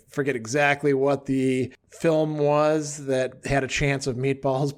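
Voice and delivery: ordinary talk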